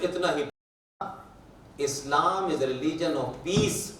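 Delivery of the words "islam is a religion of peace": very slow, stretched-out slogan; drawn-out quotation. Retold or imitated. Speaker telling a crowd the only thing he said, very slowly. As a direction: spell out the slogan at a crawl. Quoting his own line slowly.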